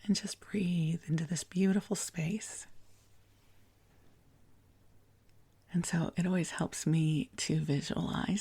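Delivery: gentle meditation prayer voice; spa whisper. Acted. Leads guided prayer meditation.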